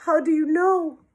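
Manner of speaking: high-pitched worried question; whimpering. A narrator's reading. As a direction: ask it in a mild whimper. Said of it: "she whimpered".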